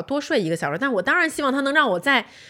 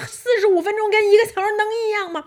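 retold angry yell; 10 semitones higher, faster than usual. Wife yelling at husband over lost sleep, high and angry. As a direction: fire it back, outraged. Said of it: "我们俩就在那儿狂吵".

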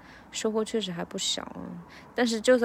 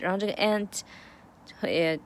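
normal talking voice

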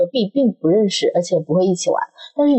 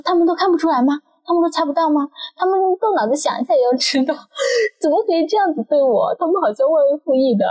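indignant complaint; 9 semitones higher than usual. Retold or imitated. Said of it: "我说".

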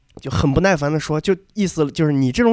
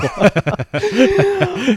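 long high-pitched laugh falling away; 9 semitones higher than usual. Real, not acted. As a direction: laugh it out, then let it drop. Laughing at own stalling. (拉长的高声大笑，尾音下落)

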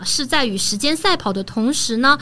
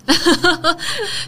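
real amused laugh, higher in pitch; hearty laugh; 6 semitones higher than usual. Real, not acted. Guest joking about not seeming an idiot, hearty laugh. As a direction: burst out laughing at your own joke.

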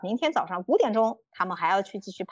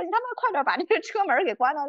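higher-pitched imitation of an urgent uncle; urging older man; 8 semitones higher, faster than usual. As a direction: re-enact a stranger's exasperated warning. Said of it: "那个大叔说".

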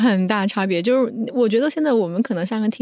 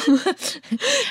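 amused laugh; 9 semitones higher than usual. Real, not acted. Amused by guest's phrase.

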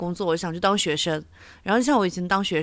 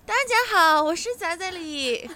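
much higher, strained squeaky voice; high-pitched voice; 7 semitones higher than usual. Pretend. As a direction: push the pitch way up. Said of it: "那我从今天开始用更高的音调说话吧".

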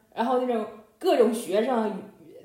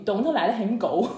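Northeastern English. Retold or imitated. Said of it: "太东北口音的感觉".

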